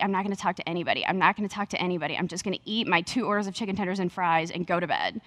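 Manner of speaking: low, quick, soft self-talk; imitated tired resolve, low and rapid; dead tired. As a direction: grumble it quietly to yourself, worn out. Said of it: "I was dead tired".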